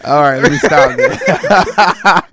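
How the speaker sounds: slow, loud, through laughter; can't stop laughing. Real, not acted. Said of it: "But why are you smiling?".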